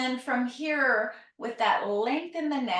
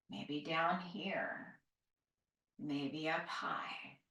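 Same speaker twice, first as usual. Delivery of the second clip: soft slow murmur; 4 semitones lower, 9 dB quieter, slower than usual. Acted. Soft slow cue while stretching.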